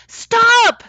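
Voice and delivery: very high, loud yell; Jared yells at Marita to stop, very loud and high; shout. Acted. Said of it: "shouted Jared".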